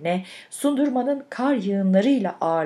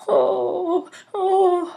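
high-pitched tearful "oh" moan; long high wails in an old woman's voice; 11 semitones higher than usual. A narrator's reading. Lonely woman weeping after her song, high wailing. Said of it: "ihtiyar kadın sesiyle".

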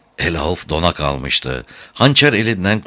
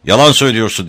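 raised, loud accusing cry; shout; 5 semitones higher, 6 dB louder, much faster than usual. A narrator's reading. Tom accuses the man holding his brother, shouting. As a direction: fling the accusation out loudly. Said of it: "diye bağırdı".

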